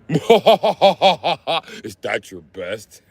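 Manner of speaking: slow, high, laughing challenge; laughing taunt. Pretend. Mechanic taunting an opponent while laughing.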